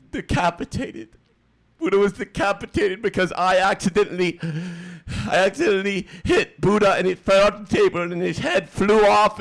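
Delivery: high, loud put-on wail of remorse; mock emotional. Streamer confessing he decapitated Buddha, hammed-up emotional. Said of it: "this is gonna get me more emotional".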